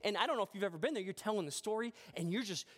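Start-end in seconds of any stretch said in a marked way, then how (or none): none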